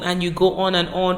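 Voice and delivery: normal talking voice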